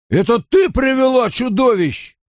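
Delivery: high, strained shout; accusing yell. A narrator's reading. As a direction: yell the accusation over a crowd. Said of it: "орал Дарл". (обвиняющий громкий крик)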